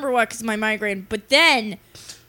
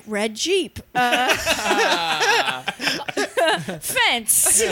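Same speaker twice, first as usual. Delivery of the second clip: put-on childlike naming; 2 semitones higher, much slower than usual. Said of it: "Like a child learning English for the first time".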